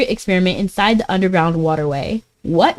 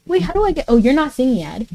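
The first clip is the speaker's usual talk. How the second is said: ordinary talk, nothing marked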